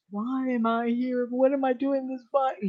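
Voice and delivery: a touch of high-voiced distress, retold; mildly voiced upset. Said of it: "He was so upset".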